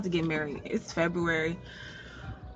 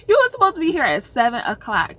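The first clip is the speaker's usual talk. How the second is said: fast, louder scolding the ex; mock scolding; 3 semitones higher, 7 dB louder, faster than usual. Scolding the husband's ex for being late, fast and louder. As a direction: rattle it off, exasperated. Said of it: "I'm like".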